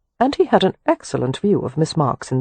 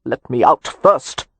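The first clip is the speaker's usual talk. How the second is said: muffled; 3 semitones lower, slower than usual. A narrator's reading. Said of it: "came the muffled reply".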